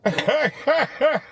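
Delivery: a high, real burst of laughter. Real, not acted. Vlogger laughing at the short border wait. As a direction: laugh out loud, delighted.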